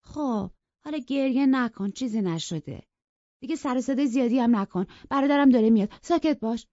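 high, contemptuous hush; scornful girl. Acted. Cathy scornfully tells Edgar to stop crying. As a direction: brush off his tears with disdain. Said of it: "با لحن تحقیرآمیزی گفت".

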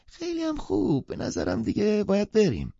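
high put-on voice of a haughty guest; no thanks, just wanting to leave; pitched far up, dismissively; curt, thankless. A narrator's reading. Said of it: "بدون هیچ تشکری گفت".

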